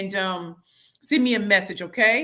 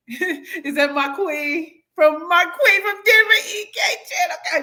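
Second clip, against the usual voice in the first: delighted recognition; 9 semitones higher, 5 dB louder, slower than usual. Real, not acted.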